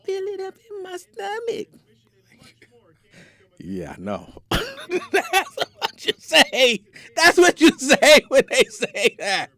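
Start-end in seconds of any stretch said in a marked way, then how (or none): 0.0-1.7 high, soft imitation of a woman; mock woman's voice. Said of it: "When they be grabbing their stomach". 4.5-9.5 laughing through the words. Real, not acted. Laughing at own impression.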